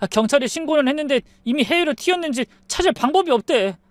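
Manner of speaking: high-pitched whining husband; aggrieved husband. A narrator's reading. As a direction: pour out a grievance on your knees. Kneeling husband complains of betrayal.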